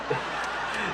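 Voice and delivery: real breathy chuckle; quiet laugh at his own retelling. Real, not acted. Cracks up at the retold line.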